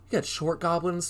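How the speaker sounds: usual speaking voice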